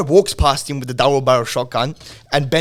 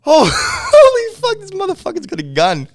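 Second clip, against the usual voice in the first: high, loud shocked yelp that settles down; 10 dB louder than usual. Retold or imitated. Retelling the moment he saw the shotgun, a high shocked yelp. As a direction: imitate your own panic on spotting the gun. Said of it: "I'm like".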